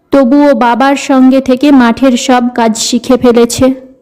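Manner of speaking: plain reading voice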